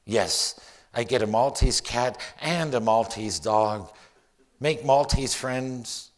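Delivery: loud, emphatic poetry reading; poem recital. Acted. Poet dreaming up a whole new life in Malta, theatrical poem recital. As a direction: build each what-if into a rising, projected daydream. Reads own poem aloud.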